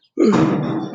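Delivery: sharp acted gasp. Archie gasping at the fight, sharp gasp. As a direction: gasp in alarm. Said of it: "Archie gasped".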